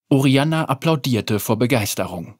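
ordinary delivery

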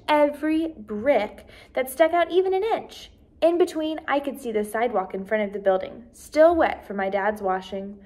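usual speaking voice

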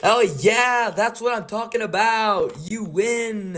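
host celebrating the game winner. Real, not acted. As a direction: cheer the winner on.

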